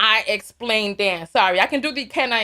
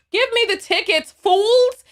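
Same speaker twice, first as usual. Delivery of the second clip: defiant shout; 10 semitones higher, 4 dB louder, slower than usual. Real, not acted. Daring racist callers to pay.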